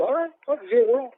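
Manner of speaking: high-pitched throwing up of hands; retold exasperated surrender. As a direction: give in loudly, in disbelief. Retells giving in to his brother.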